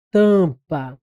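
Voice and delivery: TAMPA with a long nasal first vowel, "tã-pa"; vogal nasal demonstrada. Shown as a demonstration. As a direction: stretch the nasal "ã" in TAMPA, then a short "pa". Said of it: "É como se eu falasse assim". (TAMPA com o "ã" nasal bem alongado)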